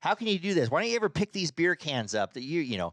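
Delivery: wife nagging about beer cans, voiced high; mock nagging wife. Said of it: "it proceeds to this".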